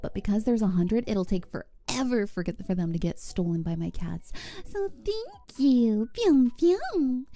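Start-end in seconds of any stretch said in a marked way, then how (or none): 4.6-7.2 high, cutesy thank you; squeaky playful gratitude. Acted. Cute thanks again.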